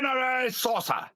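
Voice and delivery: high, mock Japanese accent, hammed up; overdone Japanese accent on Shinri Sosa; bad Japanese accent. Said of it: "Nottingham Japanese".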